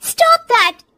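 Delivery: high, sharp angry shout; loud, angry burst. A narrator's reading. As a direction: blurt it out loudly in temper. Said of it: "जोर से गुस्से से बोल देती है".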